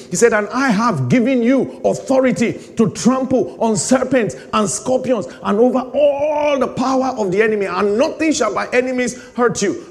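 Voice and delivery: loud, high sermon delivery; full-force preaching. Acted. Preacher urging the church to trust God, loud preaching. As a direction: drive the scripture home.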